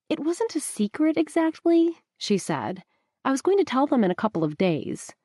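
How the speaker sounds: lightly voiced young woman explaining herself; Rebecca, defensive. A narrator's reading.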